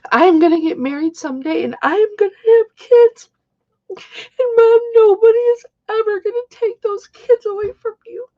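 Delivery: real tearful high voice; high-pitched, tearful and overcome; crying. Real, not acted. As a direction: choke through the words in tears. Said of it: "I'm sorry I'm so sensitive".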